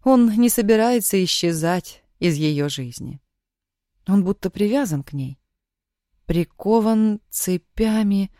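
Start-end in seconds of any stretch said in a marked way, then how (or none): none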